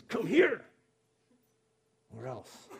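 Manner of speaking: sharp, high bark of come here; a harsh parent's threat; demanding command. Retold or imitated. Stern parent summoning a child with a harsh call. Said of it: "that demanding tone that says".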